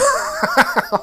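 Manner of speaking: a high-pitched burst of laughter. Real, not acted. Laughing at his own comeback to donors, high cackle. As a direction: break into a squealing laugh.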